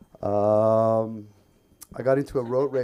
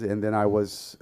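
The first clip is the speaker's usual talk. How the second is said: normal talking voice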